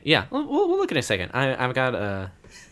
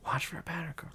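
whisper; 7 semitones higher, 11 dB quieter, faster than usual. Real, not acted. Asking the group in an aside.

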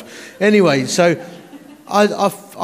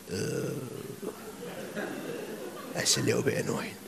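muttered annoyance; 2 semitones lower, 16 dB quieter than usual. Retold or imitated. Preacher grumbles to himself after June's call, unvoiced mutter. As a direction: mutter it to yourself. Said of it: "I'm like".

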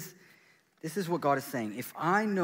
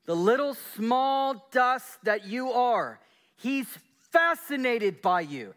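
higher and louder, fervent preaching; 8 semitones higher, 7 dB louder than usual. Acted. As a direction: preach it with rising fervour. Preaching at full force.

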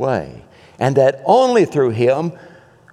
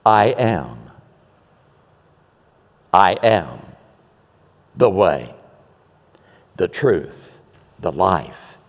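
much lower, slow, solemn voice of Jesus; Jesus replying; 8 semitones lower, slower than usual. Acted. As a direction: let each "I am" fall low and weighty. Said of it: "And Jesus replies".

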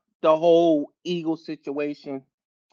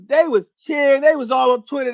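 ordinary talk

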